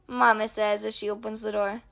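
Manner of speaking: ordinary delivery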